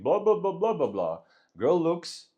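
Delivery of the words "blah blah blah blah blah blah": high, loud mock babble that drops off; loud filler talk, high at first; loud attention-getting chatter. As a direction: blurt it out to turn heads. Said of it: "He does the same thing".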